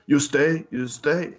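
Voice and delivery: amateur mock Russian accent; imitated Russian accent. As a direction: imitate a Russian host with little English. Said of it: "Very thick Russian accent".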